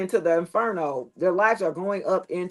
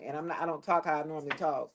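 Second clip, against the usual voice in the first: voice drops lower; 5 semitones lower, 8 dB quieter, faster than usual. Shown as a demonstration. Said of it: "my voice goes down a tone".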